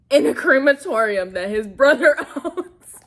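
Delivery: loud, high, breaking into laughter; laughing. Real, not acted. Recapper telling viewers the crematorium plan, laughing. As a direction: laugh through the absurd detail. Amused at absurd plot detail.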